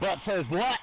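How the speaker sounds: "But says what?" high and cheeky; mock retort. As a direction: toss it back high, teasing. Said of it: "acted reply to coworkers".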